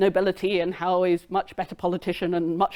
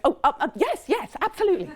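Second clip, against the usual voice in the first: flustered high; 8 semitones higher, faster than usual. Retold or imitated. Flustered wife forgiving husband.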